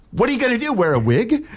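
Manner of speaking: high-pitched bad English accent; imitated Cockney woman, high; mock Cockney. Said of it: "she was real cockney".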